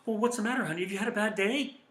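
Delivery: high, loud, strained concern; anxious high-pitched fake concern; tense defensive tone. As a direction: ask with your guard already up. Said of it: "the tone of your voice shows it".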